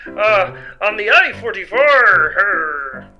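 flustered fake pirate accent with nervous laughs; faked pirate accent. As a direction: bluff a pirate backstory, stalling. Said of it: "plan to imitate a pirate".

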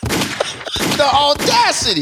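drawn out, spaced slowly; stretched-out the audacity; slow stunned remark. Real, not acted. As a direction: savor each syllable in disbelief. Friend's stunned reaction.

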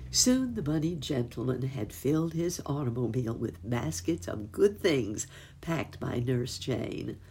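plain reading voice

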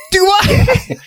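high, crying panic; panicked cry. Retold or imitated. Retelling the crewman's freakout, high crying panic. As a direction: wail it in terror. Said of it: "It's like".

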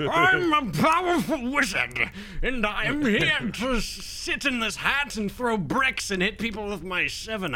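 high, loud, silly wizard voice; gnome wizard. Retold or imitated. Imitating a buddy's gnome wizard, silly high voice. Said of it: "he has that kind of voice".

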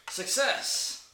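loud, high cheer at a winning roll; triumphant shout. Real, not acted. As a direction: crow it out in triumph. Celebrating a successful dice roll.

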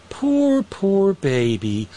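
squeaky high then drawn-out pity; mock coo, pitch jumps up; mocking baby talk. Mocking the fired forecaster.

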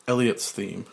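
ordinary delivery

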